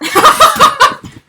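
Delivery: high, loud burst of laughter. Real, not acted. Vlogger who just showed her address, loud laugh.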